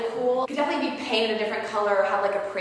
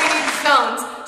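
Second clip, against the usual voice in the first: emphatic; 5 semitones higher, 7 dB louder, slower than usual. Pretend. Echoing slowly.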